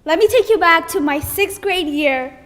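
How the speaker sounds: ordinary delivery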